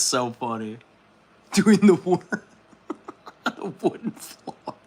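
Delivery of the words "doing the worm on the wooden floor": higher, breathy laughing words. Real, not acted. Picturing a viewer doing the worm, giggling.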